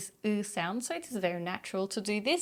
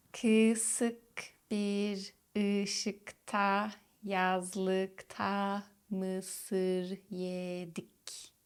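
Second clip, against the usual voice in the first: "Kısık bir ışıkta, yazlıkta mısır yedik" very slowly and softly, tongue kept back; slow demo; 4 dB quieter, much slower than usual. Shown as a demonstration. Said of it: "Practice this sentence".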